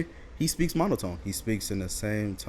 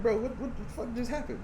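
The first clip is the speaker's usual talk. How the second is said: bewildered; 12 semitones higher, 4 dB quieter than usual. Retold or imitated. Dazed after long shoot.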